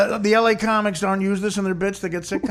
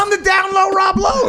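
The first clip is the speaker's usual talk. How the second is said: higher and louder, belting the pun; punchline shout; 11 semitones higher, 5 dB louder than usual. Acted. To the room, yelling his own pun loudly. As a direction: shout the catchphrase like a jingle.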